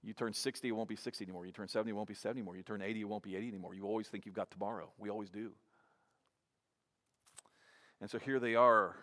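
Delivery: ordinary talk, nothing marked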